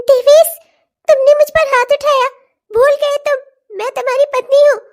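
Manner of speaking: wife shocked her husband hit her; shocked outcry. Acted. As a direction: cry out his name, stunned. Slapped wife cries out.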